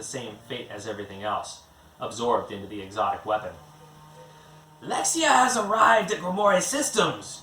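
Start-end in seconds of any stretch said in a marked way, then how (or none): 4.8-7.4 high, tense, urgent villainess voice; a high-pitched, louder alien voice reporting urgently; Giseldra's hissing voice. A narrator's reading. Giseldra hisses a report into Ganon's ear mid-firefight, high and urgent. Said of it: "Giseldra hissed into Ganon's ear".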